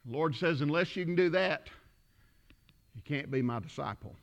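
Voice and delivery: low, hushed scripture quote; solemn preaching. Acted. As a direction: drop low and soft to let it land. Solemn drop after the climax.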